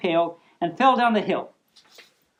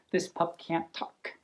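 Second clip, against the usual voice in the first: unvoiced, much softer, half to herself; whispered aside; 6 semitones lower, 12 dB quieter than usual. Real, not acted. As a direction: drop to a whisper for a quick aside. Whispered aside about the puppet.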